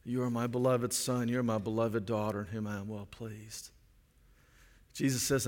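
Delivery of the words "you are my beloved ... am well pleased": soft, lower, tender declaration; tender fatherly voice. Retold or imitated. God the Father welcoming his children, soft and tender. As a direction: say it gently like a loving father. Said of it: "And he says".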